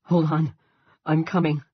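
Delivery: shouting to reach a distant friend. A narrator's reading. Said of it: "Andy shouted".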